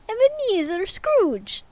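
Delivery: high, slow Russian name; Russian accent. Acted. Ghost names Scrooge in slow Russian accent. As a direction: still stuck in Russian. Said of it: "that's still Russian".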